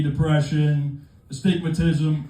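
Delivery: ordinary talk, nothing marked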